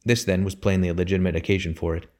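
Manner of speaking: usual speaking voice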